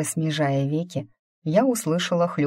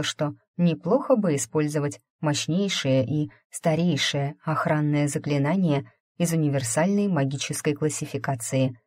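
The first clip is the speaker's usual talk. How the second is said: usual speaking voice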